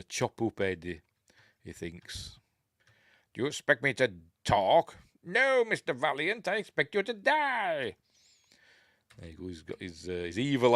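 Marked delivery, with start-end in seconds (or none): 3.3-8.0 mock villain. Quoting villain line from film.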